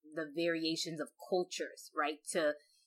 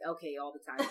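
laughing; 4 semitones higher, faster than usual. Real, not acted. Laughing admission after correction.

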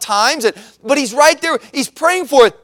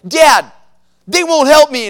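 put-on higher, louder tattling kid; complaining son; 3 semitones higher, 5 dB louder, slower than usual. Son comes inside to tell on sisters, complaining loudly. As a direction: imitate your boy calling to Dad with a complaint.

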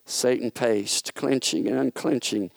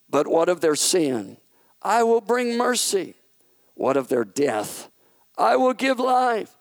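raised, ringing proclamation; proclaiming king; 8 semitones higher, 3 dB louder than usual. Acted. God proclaiming to Satan that He will become flesh, raised. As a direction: declare each promise higher and stronger. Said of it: "proclaimed the king".

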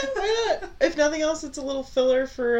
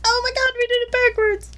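laughing surprise; 12 semitones higher, 6 dB louder than usual. Real, not acted. Realizes intro swapped names.

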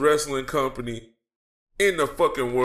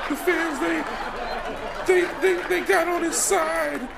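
high, giggly mock gushing voice, much higher; mocking fanboy podcasters; 13 semitones higher than usual. Mocking podcasters gushing over a Cody promo, in a high giggly fanboy voice. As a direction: ham up a giddy fan swooning over a promo. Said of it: "They're like".

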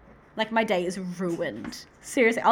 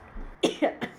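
coughing fit. Real, not acted. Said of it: "I keep coughing".

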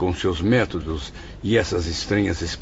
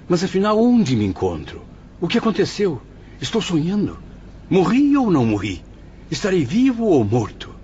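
high-pitched, urgent, bewildered questions; pleading for an answer he fears; anguished questioning; 9 semitones higher than usual. Acted. Camilo asking the doctors if he died, anguished high voice. Camilo panics about being dead.

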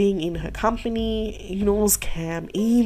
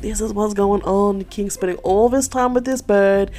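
loud, raised-pitch mock griping; acted complaint; 3 semitones higher, 6 dB louder than usual. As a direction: whine to mom about being ignored, hammed-up. Cam complains to her mom.

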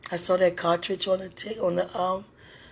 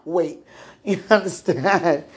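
normal talking voice

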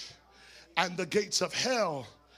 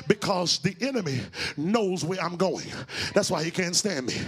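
normal talking voice

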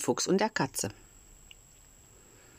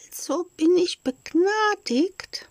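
very high, slow, thin little voice; a tiny, timid voice; 14 semitones higher, 4 dB louder, slower than usual. A narrator's reading. Kasperle asking the director if he is pardoned, very high and thin. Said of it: "mit kaum hörbarem dünnen Stimmchen".

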